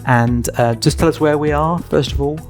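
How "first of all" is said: ordinary talk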